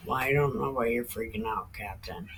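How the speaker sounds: soft exasperated aside to Captain; mutter to dog. Real, not acted. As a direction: grumble it under your breath.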